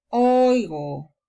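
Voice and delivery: oigo with the oi held long; long vowel. Shown as a demonstration. Teacher stretching the diphthong in oigo. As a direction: draw out the oi in oigo. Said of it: "Si hay diptongo, vamos a alargar".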